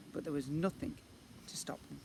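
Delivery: ordinary delivery, nothing marked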